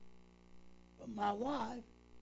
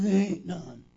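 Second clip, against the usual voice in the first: louder, firm retold wife's voice; a hint of a gruff wife, said louder; rough-voiced wife; 3 semitones lower, 10 dB louder than usual. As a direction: let them know she meant it. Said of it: "She had a rough voice".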